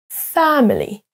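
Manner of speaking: "Family" as high FA-mi-ly, falling after; first-syllable stress. Shown as a demonstration. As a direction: lean hard on FA in "Family". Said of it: "c'est la même chose pour family".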